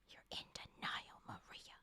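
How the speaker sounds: hushed whisper to the page; soft whispered reproach. Acted. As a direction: whisper it like a secret jab. Scolding the character under her breath.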